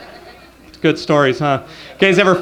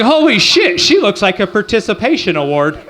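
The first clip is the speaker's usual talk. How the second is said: shocked outburst. Retold or imitated. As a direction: blurt it out in mock shock. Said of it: "I'm like".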